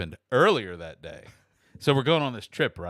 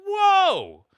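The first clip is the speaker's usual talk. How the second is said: high, loud drawn whoa; shocked whoa; 9 dB louder than usual. Retold or imitated. Caller stunned by agent's rant, high loud whoa. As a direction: recoil in disbelief.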